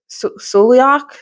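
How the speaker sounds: Suliak tried slowly, rising like a question; sounding out Suliak haltingly with a doubtful rise; unsure name attempt. Real, not acted. Reviewer stumbling on the hero's name, Suliak tried slowly as a question. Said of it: "I can't say his name".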